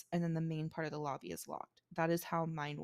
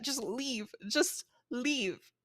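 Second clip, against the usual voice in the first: higher-pitched, building to a louder dismissal; exasperated order; 5 semitones higher, 4 dB louder, much slower than usual. Retold or imitated. Clerk telling an angry guest to go, exasperated. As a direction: order a difficult guest out, fed up.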